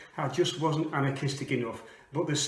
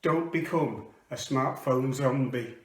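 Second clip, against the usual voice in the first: rhythmic poetry reading; poem recitation; 5 semitones higher than usual. Acted. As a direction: perform it like spoken word. Performs a comic poem.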